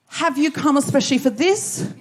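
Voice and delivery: ordinary talk, nothing marked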